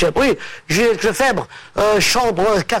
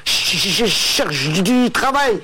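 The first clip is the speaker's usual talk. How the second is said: stammering. Acted. Stuttering man telling his friend he seeks work, stammering. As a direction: repeat the first syllables, stuck on each word. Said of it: "C'est un mec qui bégaye".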